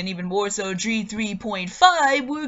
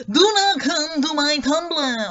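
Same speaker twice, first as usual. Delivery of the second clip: louder and higher, slow and deliberate; all caps emphasis; 5 semitones higher, 4 dB louder, slower than usual. Real, not acted. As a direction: say it like it's typed in all caps, a firm warning. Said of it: "all caps".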